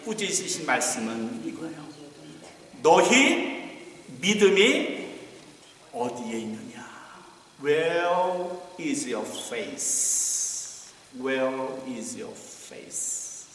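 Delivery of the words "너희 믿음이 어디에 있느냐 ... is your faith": drawn out and low, each word weighted; a grave rebuke, spoken very slowly; slow solemn rebuke. Acted. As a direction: let the question sink in slowly. Jesus rebukes the disciples.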